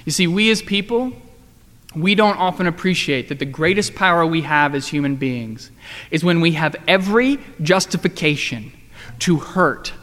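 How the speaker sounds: ordinary talk, nothing marked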